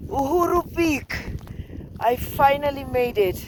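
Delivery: normal talking voice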